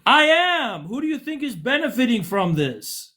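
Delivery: high, indignant comeback; exasperated retort. Real, not acted. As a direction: pitch it way up in exasperated protest.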